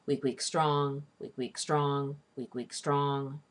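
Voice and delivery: "weak, weak, STRONG" chanted in a steady repeated rhythm; two light beats on "weak, weak", then land on "strong"; anapest beat. Shown as a demonstration. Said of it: "hear the devil's beat at work".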